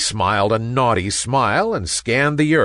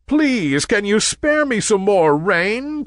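higher pleading voice; 10 semitones higher than usual. A narrator's reading. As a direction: beg for just a little more. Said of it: "pleaded the sun".

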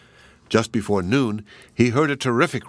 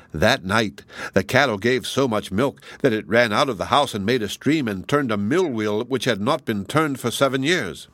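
ordinary delivery, nothing marked

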